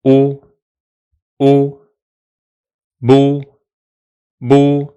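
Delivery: "u" on its own, then "bu" built from it; building up a syllable; vowel then syllable. Shown as a demonstration. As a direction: say "u" twice, then "bu" twice. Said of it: "so we do".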